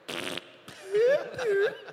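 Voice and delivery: high giggling burst; high giggle. Retold or imitated. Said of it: "he's like".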